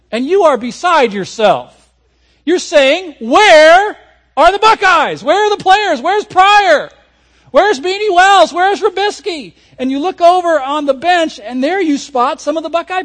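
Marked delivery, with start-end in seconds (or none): 3.2-9.5 high, loud, desperate calling; frantic fan shouting. Retold or imitated. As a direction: yell for the missing players. Fan searching for missing players.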